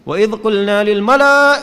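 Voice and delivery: demonstrating the wrong, strained way; too high on purpose. Shown as a demonstration. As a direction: deliberately overblown loud recitation. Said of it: "Jadi jangan ditinggiin bacanya".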